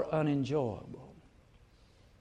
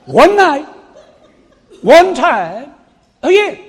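much higher, much louder "One night, one time a year"; shouted emphasis; 10 semitones higher, 15 dB louder than usual. Real, not acted. Preacher exclaiming to congregation about the bloom, shouted. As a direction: belt out "One night" with a laugh.

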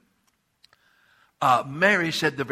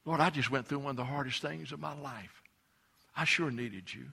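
softer, hurting plea to the Lord; pleading Martha; 8 dB quieter than usual. Acted. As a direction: quietly voice her broken question. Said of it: "It's like she's saying".

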